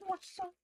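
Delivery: CROISSANT in a tiny, very high mock-French squeak; silly croissant. Shown as a demonstration. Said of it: "the silly way of just saying".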